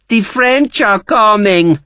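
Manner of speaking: high, slow, cackling taunt; taunting old woman. A narrator's reading. The Marquesa taunts Sharp, high slow mocking crone voice. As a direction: sing it out to mock him. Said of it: "she taunted him".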